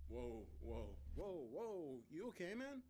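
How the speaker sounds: startled roommate checking on a friend; startled concern. A narrator's reading. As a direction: pitch climbing with alarm.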